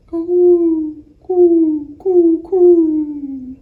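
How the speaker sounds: high, loud cooing dove call; sing-song bird call. Acted. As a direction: coo it out like a dove. Reader voices dove call.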